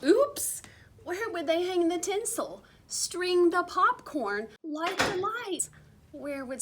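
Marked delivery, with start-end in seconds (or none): 0.0-0.6 high loud startled oops; surprised oops. A narrator's reading. Sudden exclamation in story. 1.0-5.7 alarmed questions. A narrator's reading.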